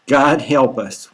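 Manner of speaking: ordinary talk, nothing marked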